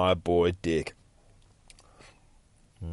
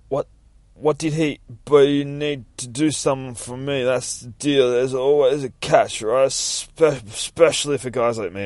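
Guppy voice; 5 semitones higher, 5 dB louder than usual. Acted. Guppy voice, higher.

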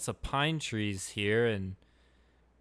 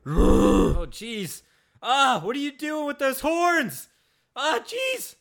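panicked shriek; 13 semitones higher, 11 dB louder than usual. Acted. Sees stranger with horns.

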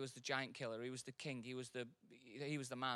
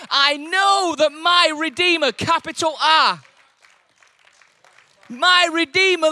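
full-force preaching; 11 semitones higher, 17 dB louder than usual. Acted. Preacher shouts Job's faith to the congregation. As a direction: cry it out over the church.

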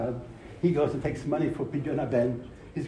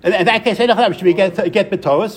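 loud, raised-pitch argument; forceful rebuttal; 4 semitones higher, 8 dB louder than usual. Real, not acted. Teacher debating the get with a questioner, forceful rebuttal. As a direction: grant the point but press on loudly. Conceding, then insisting over questioner.

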